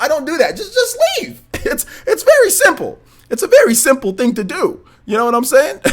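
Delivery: high-pitched, laughing exasperation. Real, not acted. Streamer telling complaining viewers to just leave, laughing. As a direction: laugh through it, voice climbing high.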